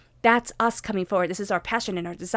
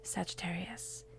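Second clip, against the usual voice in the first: guided meditation; 10 dB louder than usual. Acted. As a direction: breathe with them, then bless them in a whisper. Leads a closing meditation.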